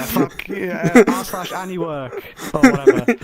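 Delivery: exasperated. Pretend. Acting upset at lost job.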